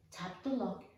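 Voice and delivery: ordinary delivery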